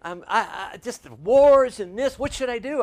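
hammed-up anxious phone caller; panicky caller. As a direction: imitate a worried believer in a flap. Retold frantic caller on phone.

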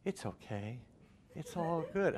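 soft, placid cult-member imitation; mock serene, quiet reply; glazed cult member. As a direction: say it hushed and blissfully unbothered. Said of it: "they had that glaze".

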